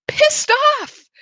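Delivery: a sudden high, squeaky burst of indignation; indignant outburst. Retold or imitated. To the listeners, recalling the bill call, a high indignant squeak. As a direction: spit the words out as if still fuming.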